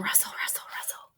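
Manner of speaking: whisper. Acted. Narrating sound in video.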